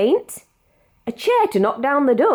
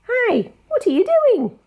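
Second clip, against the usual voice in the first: squeaky, much higher curious greeting; high character voice; 13 semitones higher than usual. Acted. Reader voicing a curious character calling up the tree, high and bright. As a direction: pop up high and surprised, like a little creature.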